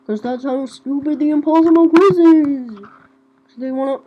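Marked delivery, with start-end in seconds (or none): none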